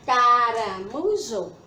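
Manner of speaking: caramujo drawn out, syllable by syllable; slow syllable demonstration. Shown as a demonstration. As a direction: stretch caramujo slowly to show its syllables. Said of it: "Quatro sílabas".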